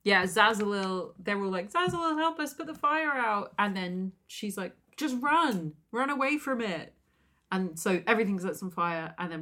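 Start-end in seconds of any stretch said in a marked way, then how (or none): 1.7-3.5 high-pitched mock panic; acted alarm, voice jumping up; panicked plea. Acted villagers panicking at fire. 5.0-6.9 mock-urgent cry, high and louder; acted alarm; urgent warning. Acted urgent warning about fire.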